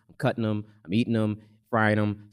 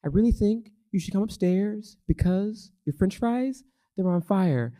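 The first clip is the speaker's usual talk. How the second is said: higher, calm little sister; 9 semitones higher than usual. Retold or imitated. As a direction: imitate the sister, unbothered, pitched up. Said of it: "she's like".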